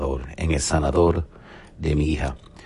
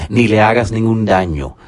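angel's command; 5 semitones higher, 7 dB louder than usual. Acted. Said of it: "le dijo el ángel".

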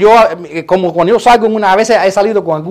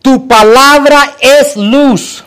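high, loud, drawn-out proclamation; preaching declamation; 5 semitones higher, 5 dB louder, much slower than usual. Real, not acted. Preacher praising God's word before congregation, loud slow declamation. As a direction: proclaim it slowly at full force.

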